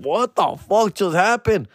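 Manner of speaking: slow, high, awed what just happened; excited disbelief. Retold or imitated. Comic retells getting the gig, slow high excited disbelief. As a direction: marvel slowly at your luck. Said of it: "I was super excited".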